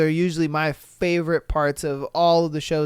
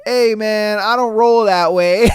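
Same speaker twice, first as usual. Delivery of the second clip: mock dad; 3 semitones higher, 6 dB louder than usual. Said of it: "I bet his dad was just like".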